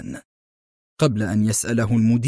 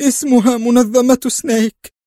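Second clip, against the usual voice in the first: much higher, louder terrified cry; trembling voice; 11 semitones higher, 5 dB louder than usual. Acted. Nasser naming the organization to the director, trembling. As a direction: blurt the name out in terror. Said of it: "ارتعد صوت ناصر".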